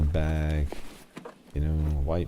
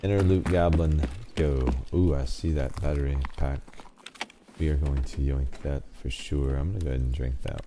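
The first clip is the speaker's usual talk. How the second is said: ordinary talk, nothing marked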